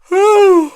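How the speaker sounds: loud high exhaled sigh; big sigh. Real, not acted. Finishing the brake job story with a loud sigh. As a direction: let out a huge sigh of relief.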